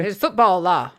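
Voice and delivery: a put-on West Texas coach voice; mock Southern accent. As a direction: preach football law like a small-town coach. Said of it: "hard not to do a terrible Southern accent".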